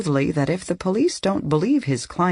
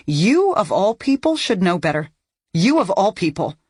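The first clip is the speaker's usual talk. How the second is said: louder, higher angry outburst; almost yelling; 6 semitones higher, 4 dB louder than usual. A narrator's reading. Daughter shoving back chair at detective, almost yelling. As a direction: snap at her, barely holding back a yell. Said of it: "I'm almost yelling at her".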